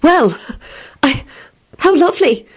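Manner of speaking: halting, high weak reply; lost for words, high-pitched; weakly. A narrator's reading. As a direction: falter politely between the words. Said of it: "Beatrice replied weakly".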